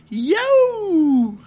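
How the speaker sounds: very high, loud drawn-out "yo" howl; coyote yell. Retold or imitated. Campers waking everyone late at night, coyote yelling. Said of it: "doing, like, coyote yells".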